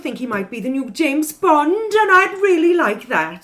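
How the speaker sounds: put-on light Welsh accent, rising excitedly. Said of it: "that was a Welsh accent, or my best attempt".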